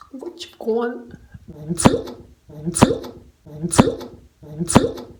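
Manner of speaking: mock echo yelling do; echoing shout. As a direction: fling the word out like an echo.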